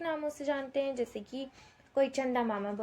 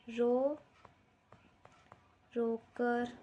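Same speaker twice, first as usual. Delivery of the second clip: drawn-out words, long pauses between; slow dictation; much slower than usual. Shown as a demonstration. Teacher giving children doubled words to write, slow dictation. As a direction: leave time to write after each word.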